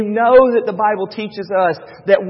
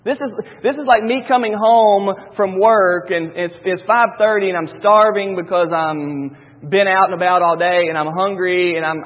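ordinary talk, nothing marked

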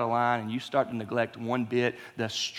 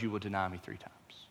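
hushed whisper; 8 semitones lower, 16 dB quieter than usual. Retold or imitated. Jesus foretelling Peter's denial.

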